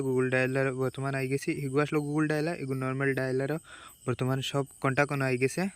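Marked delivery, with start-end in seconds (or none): none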